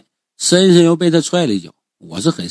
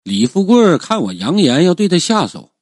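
plain reading voice

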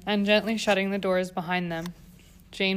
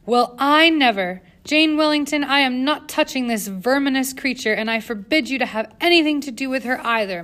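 outraged; 5 semitones higher, 5 dB louder than usual. A narrator's reading. Governess outraged.

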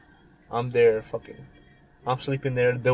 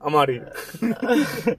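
slow, higher mock exit line; mock bail-out; 5 semitones higher, much slower than usual. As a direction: drag it out like a cartoon getaway. Friend acts fleeing cop.

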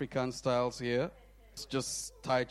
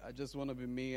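I just wanna be me repeated lower and softer; soft echo; 3 semitones lower, 10 dB quieter, much faster than usual. Real, not acted. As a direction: let I just wanna be me fall back gently.

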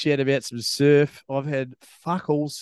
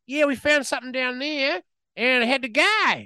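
high, offhand mock doctor voice; 11 semitones higher than usual. Doctor breaking the bad news to the patient, high and offhand. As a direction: toss off the bad news casually. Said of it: "And they're like".

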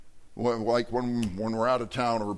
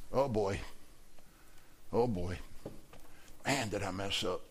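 low, hushed regret; rueful; 7 semitones lower, 11 dB quieter than usual. Acted. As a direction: let it sink in, quietly and low. Peter's regret after rooster crows.

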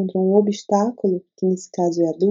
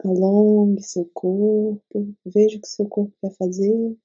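guided meditation. Acted. Leads a meditation.

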